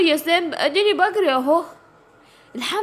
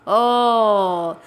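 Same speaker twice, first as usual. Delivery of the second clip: a long low predator rumble; drawn-out tiger growl; 7 semitones lower, much slower than usual. Acted. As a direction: growl slowly over the prey. (زمجرة نمر طويلة)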